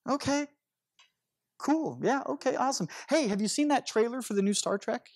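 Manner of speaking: high, over-bright okay, then a quick topic change; a friend who doubts the healing story, brushing it off awkwardly. Retold or imitated. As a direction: brush it off and change the subject fast. Buddy awkwardly changes the subject.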